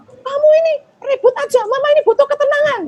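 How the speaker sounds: put-on mother yelling at her noisy kid; scolding you statement. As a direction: snap at the noisy child. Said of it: "misalnya anak nakal, kita".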